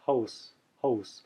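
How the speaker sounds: quiet French Canadian hose, open O. Shown as a demonstration. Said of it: "I'm pretty close there".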